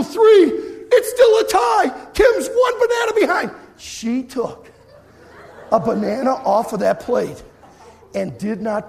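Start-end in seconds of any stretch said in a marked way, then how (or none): 0.0-3.5 high, loud final call; announcer at the buzzer; excited shout. Retold or imitated. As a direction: yell the last seconds over the crowd. Final seconds of contest.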